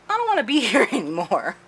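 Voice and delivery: high, loud quote breaking into laughter; mock plea cracked by a laugh; laughing mock protest. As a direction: blurt it high and fast, laughing. Voices scared captive Julia, laughing.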